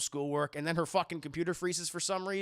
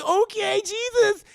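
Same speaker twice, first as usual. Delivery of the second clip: high, loud exasperated "okay, Jesus"; hammed-up high, loud fed-up squeal; exasperated shriek; 12 dB louder, slower than usual. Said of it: "And she's like".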